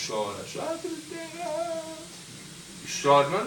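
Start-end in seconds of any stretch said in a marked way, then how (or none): 0.1-1.8 put-on much higher, wailing like a child denied a toy; a light imitation of a crying child; mock whining child. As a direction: whine like a spoilt kid. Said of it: "aí você chora".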